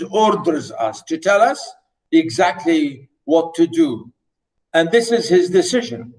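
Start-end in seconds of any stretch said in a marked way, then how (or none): none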